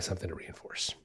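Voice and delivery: soft unvoiced hush, leaning in; whisper. Real, not acted. Trainer whispers the key point to dog owners. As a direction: let it drop to a secret whisper.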